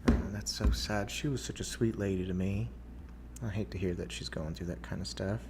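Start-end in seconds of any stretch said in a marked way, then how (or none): none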